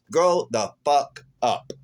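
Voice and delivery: slow, emphatic put-down; drawn-out, mildly angry reproach; retold scolding. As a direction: stretch out each word of the retort. Repeats the retort after an aside.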